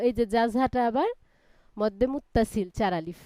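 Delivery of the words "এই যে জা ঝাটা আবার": usual speaking voice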